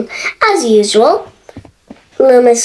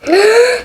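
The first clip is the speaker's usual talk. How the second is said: gasp; 9 semitones higher than usual. A narrator's reading. Dramatic gasp.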